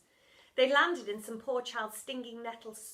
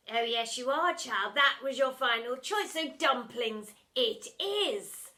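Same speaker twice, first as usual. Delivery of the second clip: gloating villain; 3 semitones higher, 8 dB louder than usual. Acted. Splat gloating.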